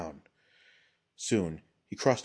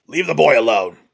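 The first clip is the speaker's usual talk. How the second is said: very loud rough command, pitched up; barked; 6 semitones higher, 15 dB louder than usual. A narrator's reading. Old potter orders ronin off the boy, barking. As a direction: bark it out across the path. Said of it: "barked Yotsu".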